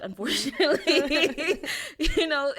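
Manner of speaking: laughing it out, loud and high. Real, not acted. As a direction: laugh through the wry confession. Laughs at her own irony.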